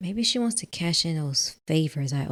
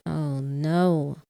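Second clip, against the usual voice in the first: ordinary delivery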